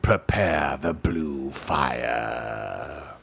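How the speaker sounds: low, very slow incantatory dread; grey voice. A narrator's reading. Grey creature commanding the ritual to begin, low and slow. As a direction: draw out every word, deep and ominous. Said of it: "said the grey voice".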